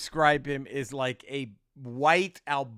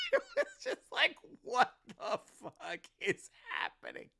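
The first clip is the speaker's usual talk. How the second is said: laughing, dumbfounded retelling; laughing disbelief; 8 semitones higher than usual. Retold or imitated. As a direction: say it stunned, voice giving out with laughter. Stunned reaction through laughter.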